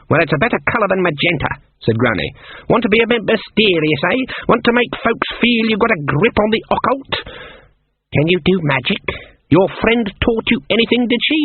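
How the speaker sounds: put-on sizing up three girls with scorn; old witch drawing out each word mockingly; sardonic old witch. Granny questions Magenta.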